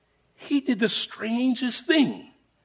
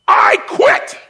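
a sudden yell, much higher and louder; top of lungs yell; 7 dB louder than usual. Retold or imitated. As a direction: explode with it like a sergeant who just broke. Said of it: "he yells out at the top of his lungs".

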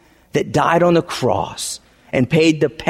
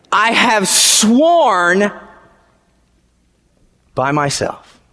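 slow solemn reading; slower than usual. Acted. Reading God's oath slowly.

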